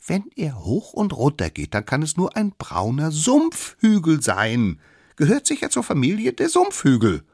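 high, loud know-it-all explanation; cocky little expert. Acted. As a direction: improvise a theory with full conviction. Youngest invents an explanation. (kleiner Besserwisser, hoch und überzeugt)

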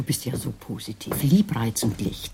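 mocking whisper, dripping with scorn; sarcastic whisper. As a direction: sneer it under your breath. Sarcastic retort in quarrel. (spöttisch geflüstert)

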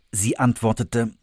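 usual speaking voice

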